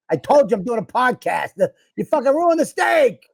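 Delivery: high-pitched, hammed-up yelling at a wife; exaggerated indignant outburst; mock raging bull. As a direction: imitate a furious husband, voice climbing high. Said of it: "a fucking raging bull on your wife".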